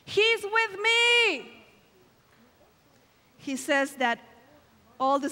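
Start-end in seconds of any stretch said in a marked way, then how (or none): 0.1-1.5 high, slow retold yell; imitated yell, drawn out on "me". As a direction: call it out long and high, as if across a distance. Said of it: "and he yelled".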